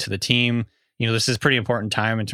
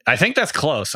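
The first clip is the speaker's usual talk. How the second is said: usual speaking voice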